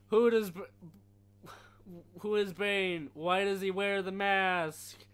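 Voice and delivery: high, loud, slow interrogating agent; annoying CIA agent voice. Retold or imitated. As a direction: yell the question like a pushy agent. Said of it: "the CIA guy has the most annoying fucking voice".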